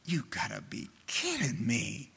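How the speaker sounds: hushed, incredulous aside; imitated disbelief. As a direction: imitate someone too stunned to speak up. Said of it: "I just could hear him saying".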